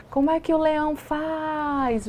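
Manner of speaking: put-on playful child-directed question. Shown as a demonstration. Therapist asking a toddler about the lion, playful high slow voice. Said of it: "A gente faz muito isso em terapia".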